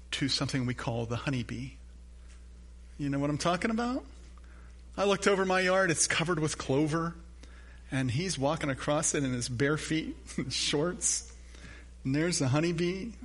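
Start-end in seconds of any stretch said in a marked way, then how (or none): none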